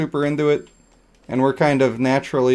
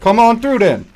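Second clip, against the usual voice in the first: higher, louder dare; mock street tough; 8 semitones higher, 5 dB louder than usual. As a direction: call them out, challenging. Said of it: "a little more urban".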